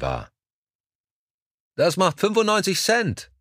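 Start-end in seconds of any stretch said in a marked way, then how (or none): 1.8-3.3 high-pitched call from behind; shouted demand for payment, pitched very high. A narrator's reading. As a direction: call after a departing customer, voice pitched way up. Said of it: "rief eine Stimme hinter ihm".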